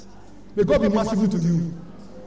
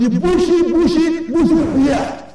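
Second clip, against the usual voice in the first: mocking emphasis; 5 semitones higher, 3 dB louder than usual. Real, not acted. Mocking the husband's beard.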